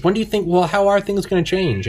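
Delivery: high-pitched mock host voice; high mock anchor. Host pressing the guest for answers, pitched high and mocking. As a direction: push it up high like a flustered host. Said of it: "he goes".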